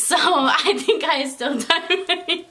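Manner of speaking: slow, giggling through the words; laughing. Real, not acted. Admitting she ties her shoe like a child, laughing. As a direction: laugh it out, embarrassed.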